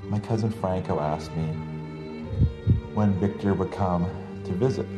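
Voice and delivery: normal talking voice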